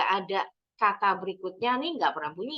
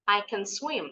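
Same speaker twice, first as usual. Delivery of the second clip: "can" swallowed fast as ken; quick can. Shown as a demonstration. Teacher shows pupils the fast positive "I can swim". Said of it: "Kalau yang ini harus cepat".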